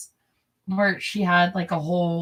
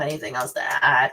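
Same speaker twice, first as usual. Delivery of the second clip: "Anything else to add?" dropped much lower, ending on a rise; much lower repeat; 7 semitones lower than usual. Shown as a demonstration. Asks herself twice, second lower.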